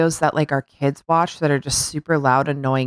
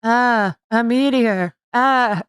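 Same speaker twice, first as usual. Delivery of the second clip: higher, drawn-out mock cry; imitating mock panic; 6 semitones higher, much slower than usual. Bestie in the water doing meteor bit, mock alarm. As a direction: bit of fake alarm, unhurried. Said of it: "she was just like".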